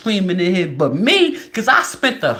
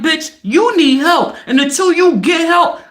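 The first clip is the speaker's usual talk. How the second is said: fed-up friend; 8 semitones higher, 4 dB louder than usual. Retold or imitated. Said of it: "Your friend is looking at you like".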